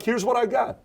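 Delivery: mock confident. Acting out owning it.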